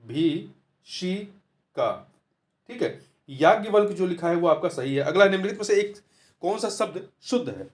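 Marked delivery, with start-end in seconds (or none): 0.0-2.1 भीषिका drawn out very slowly, each sound held; stretched teaching demo of भीषिका; syllable by syllable. Shown as a demonstration. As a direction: spell out भीषिका slowly for the class. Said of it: "भा पे जो बड़ी की मात्रा होगा".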